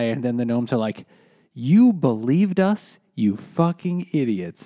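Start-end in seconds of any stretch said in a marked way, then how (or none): 1.5-4.7 slow, gloating put-on voice, starting high and loud; mocking taunt. Gnomes reveal the lie to the kids, gloating. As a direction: draw out the mock insult.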